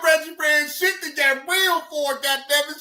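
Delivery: ordinary talk, nothing marked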